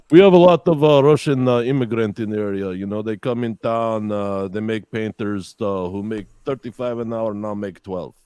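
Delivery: put-on Russian accent, starting loud and settling down; put-on Russian-accented patter. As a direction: chat as a Russian character. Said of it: "Can you do a Russian accent?".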